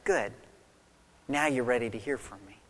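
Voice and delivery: soft, quick and quiet, as God answering; a quiet reply from God to the confused believer; gentle voice of God. Retold or imitated. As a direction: hush it down and reassure them.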